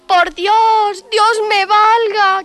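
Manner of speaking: queja aguda y lenta de vieja; lamento de vieja. Acted. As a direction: llora como una vieja asustada. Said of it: "a llorar se echó". (la vieja se lamenta llorosa, aguda y lenta)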